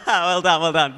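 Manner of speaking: loud well done to the crowd; loud praise. Real, not acted. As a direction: congratulate the whole room. Praising the room.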